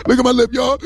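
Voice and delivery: high, hammed-up crying voice; whimpering mock sob; mock crying, lip trembling. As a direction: blubber it like a pouting child. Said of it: "started crying".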